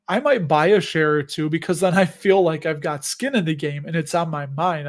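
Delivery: ordinary talk, nothing marked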